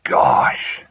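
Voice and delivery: hushed, whispered gasp of wonder; awed. Acted. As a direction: breathe it out, amazed. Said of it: "in an awed voice".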